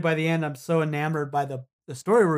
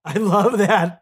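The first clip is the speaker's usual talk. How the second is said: laughing, much louder and slower; 4 semitones higher, 9 dB louder, slower than usual. Real, not acted. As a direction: laugh through the words, loud. Repeats line, second time laughing.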